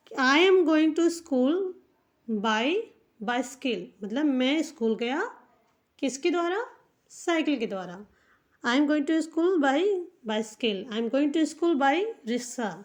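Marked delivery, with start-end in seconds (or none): 0.1-3.8 "I am going to school by bicycle" stretched, a touch louder; slow dictation. Shown as a demonstration. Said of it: "तो इससे अगर कहना है". 8.6-12.7 slow example sentences. Shown as a demonstration. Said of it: "इस तरह से हम बोल सकते हैं".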